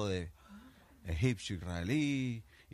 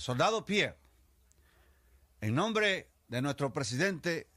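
slow, loud, higher ceremonious command; solemn officer's order; 6 semitones higher, 4 dB louder than usual. Acted. French general orders soldier Pierre to jump: slow formal proclamation. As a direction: deliver it like a pompous general.

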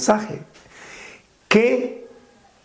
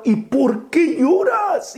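a much higher, slow, drawn-out call; pleading cry; 9 semitones higher, slower than usual. Retold or imitated. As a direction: cry out the question to her. Asking Mary why she weeps.

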